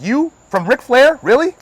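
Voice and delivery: high, loud disbelief; incredulous. Retold or imitated. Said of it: "I'm like".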